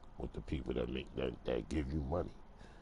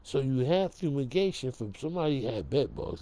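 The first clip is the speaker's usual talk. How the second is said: higher, outraged quote of himself; hammed-up protest at the front desk; retelling his complaint; 9 semitones higher, 3 dB louder than usual. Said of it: "I said".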